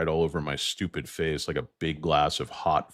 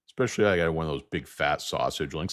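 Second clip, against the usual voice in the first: ordinary talk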